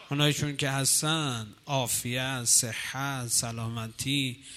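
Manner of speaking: soft, low supplication; hushed prayer. Acted. Preacher leading the congregation in prayer, soft and low. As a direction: plead quietly to God.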